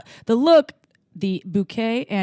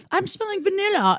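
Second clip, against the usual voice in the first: mock timid guess; 10 semitones higher than usual. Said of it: "I was like".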